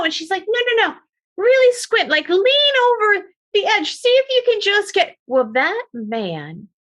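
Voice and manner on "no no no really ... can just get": high, pushy urging, louder; eager coaxing. Retold or imitated. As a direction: coax him over the edge, high and eager. Mora luring him to the edge.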